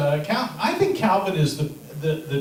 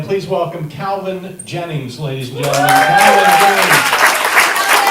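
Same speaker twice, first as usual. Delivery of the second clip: announcer intro; 6 dB louder than usual. Real, not acted. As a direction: stretch the name out loud. Host announcing next comic.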